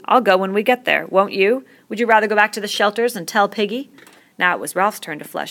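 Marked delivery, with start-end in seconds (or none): 0.0-3.9 hotly. A narrator's reading. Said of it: "said Jack hotly".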